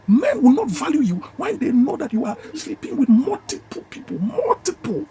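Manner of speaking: "multiple" pitched high, said slow; stress on multiple. Shown as a demonstration. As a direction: lean hard on "multiple". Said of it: "the emphasis is on the multiple".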